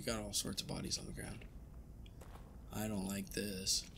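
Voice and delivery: slow, low and hushed; scary voice. Pretend. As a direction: creep them out, slowly and softly. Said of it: "Why are you saying that in such a scary voice?".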